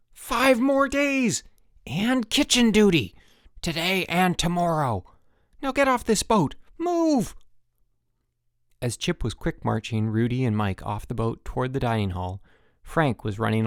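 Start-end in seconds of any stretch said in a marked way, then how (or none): 0.1-7.4 high, loud barked punishments; bellowing orders. A narrator's reading. Counselor bellowing punishments at the campers. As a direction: roar out the orders.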